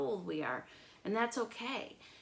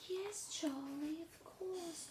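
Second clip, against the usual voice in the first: Lola's sweet reply; 10 semitones higher, 6 dB louder, much slower than usual. Acted. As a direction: play sweetly innocent. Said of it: "She said".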